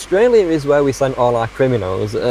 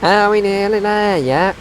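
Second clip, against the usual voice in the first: put-on whiny kid voice; 8 semitones higher than usual. Child-like whine on a long trip.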